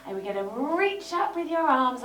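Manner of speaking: ordinary talk, nothing marked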